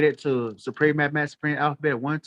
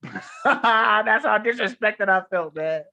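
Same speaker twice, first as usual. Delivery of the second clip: laughing; 5 semitones higher, much slower than usual. Real, not acted. Laughing at his own story.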